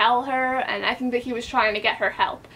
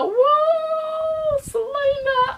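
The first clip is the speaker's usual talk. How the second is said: much higher, sung woo, Celaena; excited cheer; much slower than usual. Real, not acted. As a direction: cheer for her like a fan. Cheering the heroine.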